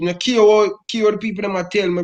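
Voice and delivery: normal talking voice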